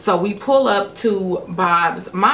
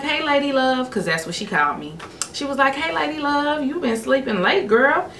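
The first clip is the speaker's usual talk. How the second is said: higher, lightly voiced mother; mom's voice; 7 semitones higher than usual. Mother greeting her daughter at breakfast, higher and light. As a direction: lift the pitch for a cheerful mom. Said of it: "And she was like".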